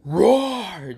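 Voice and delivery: half-hearted bear roar as a word; spoken bear roar. Retold or imitated. Bear scaring two annoying girls, mock roar.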